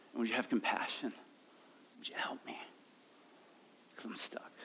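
hushed prayer fading into a whisper; very soft, helpless begging; whispered plea. Acted. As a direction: let the plea drop away to a breath. Acted desperate prayer fading to whisper.

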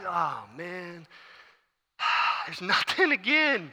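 sighing, drawn-out letdown; exasperated servant's sigh; dejected. Acted. Servant's disappointment at empty sky.

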